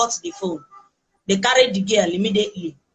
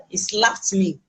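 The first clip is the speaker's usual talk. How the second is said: ordinary talk, nothing marked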